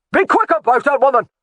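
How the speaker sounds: high, loud barked command; shouted order. A narrator's reading. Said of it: "the laird shouted that order".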